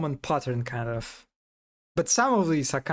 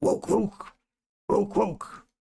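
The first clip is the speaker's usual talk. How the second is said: higher barked woke; dog bark imitation; 4 semitones higher, slower than usual. Shown as a demonstration. Showing how dogs say woke, high mock bark. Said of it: "Like, like this".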